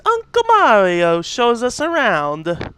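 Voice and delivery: high, swooping sing-song; silly put-on voice. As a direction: stretch the words out in a playful high lilt. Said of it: "I don't know why I'm talking like this".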